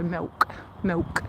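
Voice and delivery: "milk" said with a W in the middle, like Millwall; "milk" rounded into a Leicester "mewk"; Leicestershire milk. Shown as a demonstration. Viewer asks about her accent, she shows Leicestershire milk. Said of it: "with a Leicestershire accent is more like Millwall".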